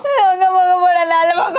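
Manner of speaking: crying as she curses; tearful cursing. Acted. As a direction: wail insults at the husband. Beaten wife cursing husband in tears. (ఏడుస్తూ తిట్టడం)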